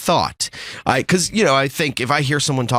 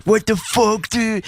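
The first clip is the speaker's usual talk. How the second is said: mock outrage; 10 semitones higher, slower than usual. Retelling his panicked thought.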